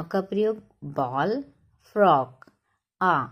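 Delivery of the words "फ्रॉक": फ्रॉक with an open aw vowel between a and o; half-open aw vowel. Shown as a demonstration. Teacher showing children the borrowed aw sound, careful. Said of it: "इसको बोलते हैं आ".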